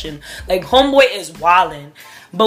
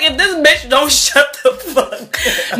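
laughing; 5 semitones higher, slower than usual. Retold or imitated. Annoyed thought, laughing.

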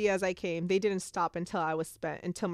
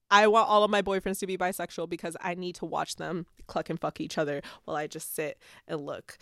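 normal talking voice

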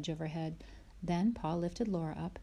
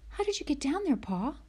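put-on much higher little girl's question; Laura asking; 10 semitones higher than usual. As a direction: ask Pa in wonder. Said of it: "she asked him".

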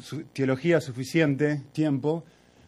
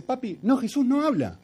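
put-on much higher child voice, insisting; be the stubborn four-year-old; little girl contradicting; 11 semitones higher than usual. Hija insiste otra vez. (voz aguda de niña que insiste)

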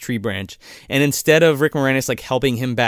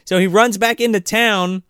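usual speaking voice

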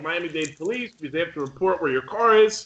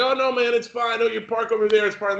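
higher, breezy reassuring street guy; lightly voiced Antonio; 6 semitones higher than usual. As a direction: wave it off like it's no big deal. Voicing homeless guy Antonio.

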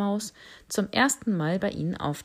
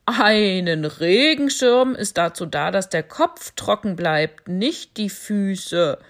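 elephant voice; 7 dB louder than usual. Acted. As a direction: correct the bat loudly, lecturing. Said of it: "sagte der Elefant". (lauter, belehrender Elefant)

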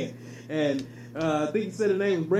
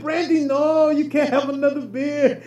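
mock Mexican accent; 6 semitones higher than usual. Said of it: "He said".

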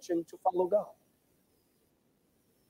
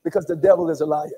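usual speaking voice

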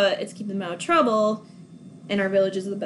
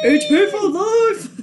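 much higher, louder, slow laughing cheer; fan cheer; 10 semitones higher, 6 dB louder, slower than usual. Real, not acted. Cheering Harry Potter with the co-host, laughing.

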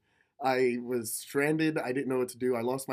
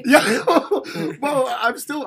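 laughing hard; 10 semitones higher, 12 dB louder than usual. Real, not acted. Laughing while telling guard story.

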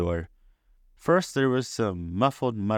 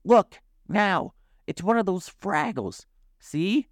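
high, insistent dog's voice; high-pitched excited pointing-out; urgent barking; 10 semitones higher than usual. A narrator's reading. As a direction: plead excitedly like a yapping dog. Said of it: "Sprocket barked".

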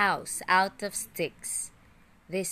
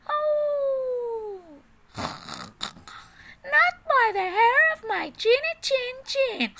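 much higher, louder, crying piglet voice; frightened little pig; 4 dB louder than usual. A narrator's reading. Little pig refusing the wolf at his straw house, high crying voice. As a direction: whimper it out like a scared piglet. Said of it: "said the little pig".

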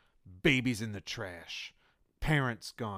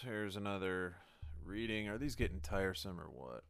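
normal talking voice

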